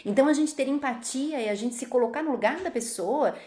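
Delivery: ordinary delivery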